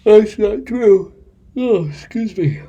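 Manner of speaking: high, loud, slow put-on voice; silly high voice. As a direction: squeak it out in a silly voice. Self-correction in put-on voice.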